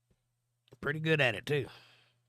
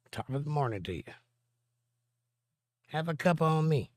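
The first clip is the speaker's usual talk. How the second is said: put-on Irish accent; 4 semitones higher than usual. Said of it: "I can do a pretty mean Irish accent".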